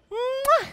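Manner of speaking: loud, high smacking kiss; big kiss. Pretend. Teacher shows children kissing their toes, loud smooch. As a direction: plant an exaggerated mwah. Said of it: "Maybe kiss your toes".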